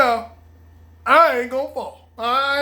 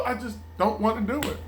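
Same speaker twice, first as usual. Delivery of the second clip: ordinary delivery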